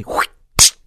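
sharp breathy whoosh of a snapping trap; airy swoosh of a trap; whoosh sound effect. Retold or imitated. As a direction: blow out a quick whoosh like the trap slamming shut.

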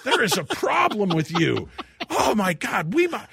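high, loud mock tirade; hysterical accusing. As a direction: rant at your wife in comic panic. Acted panicked tirade at wife.

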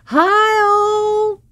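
big high sing-song hello; excited greeting. Retold or imitated. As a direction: sweep in greeting everyone at once. Girlfriend bursts in greeting.

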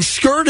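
"skirt" said as skurred, with an ur vowel; SKURRED for scared. Retold or imitated. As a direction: rhyme "skirt" with blurred, ending in d. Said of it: "She actually said scared".

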